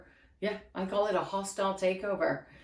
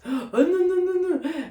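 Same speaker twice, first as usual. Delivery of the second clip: mock gasp; 8 semitones higher, 4 dB louder than usual. Mocking husband's gasp.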